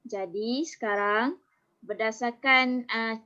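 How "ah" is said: ordinary talk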